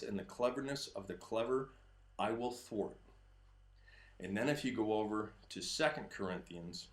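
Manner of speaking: low, measured Bible reading; a touch of solemn reading; scripture reading. Acted. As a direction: read the verses with reverence.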